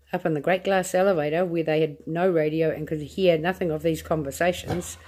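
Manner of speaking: usual speaking voice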